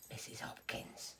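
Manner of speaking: stage whisper of a teacher's name; conspiratorial whispered aside. Acted. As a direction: whisper it behind your hand.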